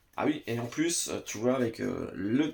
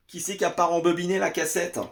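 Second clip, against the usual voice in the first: loud, high, quick mock outcry; mock scolding; 6 semitones higher, 6 dB louder, faster than usual. Collector asking who didn't rewind the tape, mock scolding. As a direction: call out in pretend indignation.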